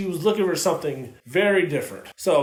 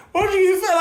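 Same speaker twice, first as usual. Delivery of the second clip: high, laughing jab at a partner; laughing mock quote; 12 semitones higher, 3 dB louder, much faster than usual. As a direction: toss it off pitched up, through a laugh. Retelling a weird argument, laughing.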